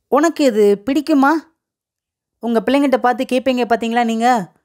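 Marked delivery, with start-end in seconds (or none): none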